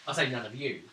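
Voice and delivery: ordinary talk, nothing marked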